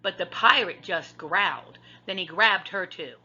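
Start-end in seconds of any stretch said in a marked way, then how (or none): none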